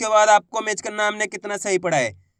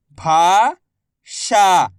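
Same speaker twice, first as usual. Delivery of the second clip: very slow, each syllable drawn out; much slower than usual. Shown as a demonstration. Teacher sounding out a word for pupils, slow syllables. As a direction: spell the word out slowly for a child. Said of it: "मैं किस तरीके से".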